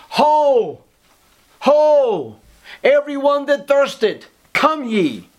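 high ringing preacher call; calling sinners to come, at full force; crying out. Acted. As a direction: call out like a herald. Preacher calls out Isaiah's Ho.

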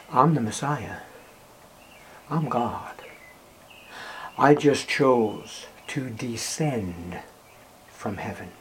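a hushed Jesus telling Nicodemus who he is; quiet Jesus voice. Acted. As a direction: let Jesus speak low and hushed. Preacher voices Jesus speaking.

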